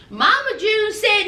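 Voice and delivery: ordinary talk, nothing marked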